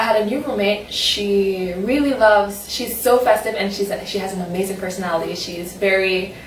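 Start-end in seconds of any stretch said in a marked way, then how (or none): none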